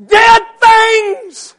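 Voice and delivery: sob-edged revival shout; crying preacher's shout. Acted. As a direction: cry it out as you preach. Preaching at full force.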